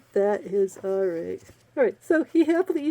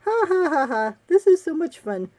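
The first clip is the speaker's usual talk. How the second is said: high, spelled-out fake laugh; hammed-up carefree glee; mock gleeful laughter; 5 semitones higher, 3 dB louder than usual. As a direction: pretend you're having a wonderful time. Hero's laughing fun in the water.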